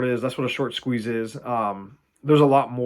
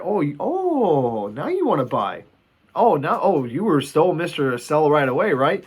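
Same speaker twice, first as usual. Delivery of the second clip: drawn-out, higher, sneering oh; mocking taunt; 4 semitones higher than usual. Stock owner gloating at a panicking short seller, mocking. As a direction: gloat at the seller who now needs you.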